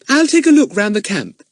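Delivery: put-on high, restless girl's voice; a bored girl getting up to go. A narrator's reading. Eunice bored.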